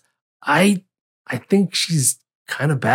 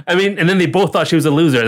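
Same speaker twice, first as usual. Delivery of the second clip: normal talking voice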